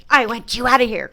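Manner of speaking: loud, right-behind-your-head demand; yelled at her back. Retold or imitated. Sister-in-law yelling behind her head as she walks off, loud. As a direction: yell it at someone walking away. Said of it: "she's coming up behind me going".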